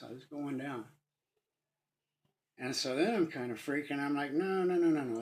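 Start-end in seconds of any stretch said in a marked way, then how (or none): 4.3-5.2 frantic rising no's in retold terror; panicked pleading. Dying man begging the fall to stop, panicked.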